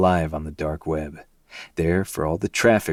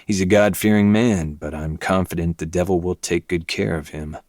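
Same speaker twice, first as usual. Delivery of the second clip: ordinary delivery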